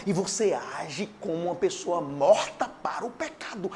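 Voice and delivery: ordinary delivery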